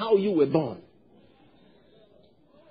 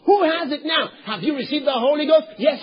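ordinary delivery

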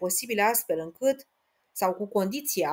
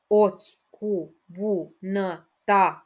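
slow, syllable by syllable; split into syllables; 3 dB louder, much slower than usual. Shown as a demonstration. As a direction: count the syllables aloud slowly. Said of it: "Merg mai departe".

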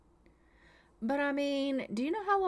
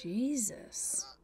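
soft breathy whisper; disbelieving whisper; whispered Jesus; 7 dB quieter than usual. Real, not acted. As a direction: mutter it under the breath. Disbelief at the realtor.

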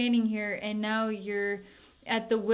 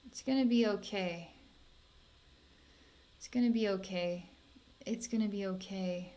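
repeated comfort, each one fading softer; reassuring; 5 dB quieter than usual. Real, not acted. Healer reassuring her upset client, fading to soft. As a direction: say it three times, letting it sink to a soft murmur.

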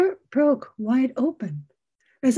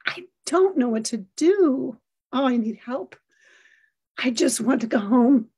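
ordinary talk

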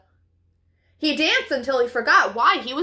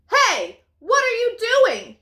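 high, loud call across the yard; hollering; 9 semitones higher, 3 dB louder, slower than usual. Acted. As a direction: holler at them from afar. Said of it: "he hollered".

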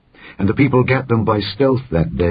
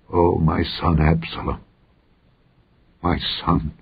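weeping grief; 3 semitones lower, 4 dB quieter than usual. A narrator's reading. King mourns his dead son Absalom, grieving softly. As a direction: grieve softly and haltingly, fading away to nothing. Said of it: "and wept. And as he went, thus he said".

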